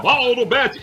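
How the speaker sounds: entonação de locutor. Retold or imitated. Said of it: "foi fazendo várias entonações".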